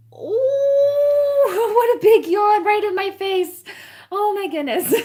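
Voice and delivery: loud, very high squeal of surprise with a laugh; surprised laughter. Real, not acted. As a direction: react to a yawn right in your face. Puppy yawns in her face.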